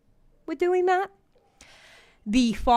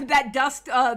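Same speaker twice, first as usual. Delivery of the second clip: ordinary talk, nothing marked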